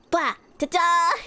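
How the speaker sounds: loud, high surprise pop; playful jump scare. Pretend. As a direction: jump out at them. Jump-scare attempt on chat.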